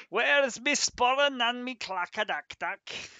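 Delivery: put-on over-the-top Scottish accent; mock Scottish. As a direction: play a cartoon Highlander. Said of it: "The deep north".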